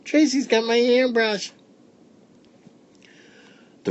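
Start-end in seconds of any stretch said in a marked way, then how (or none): 0.0-1.5 put-on high excited kid shouts; yelling children. A narrator's reading. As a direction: shout like kids in a chase. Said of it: "all yelling".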